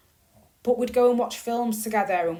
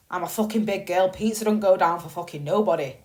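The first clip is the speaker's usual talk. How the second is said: ordinary delivery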